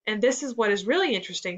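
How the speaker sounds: usual speaking voice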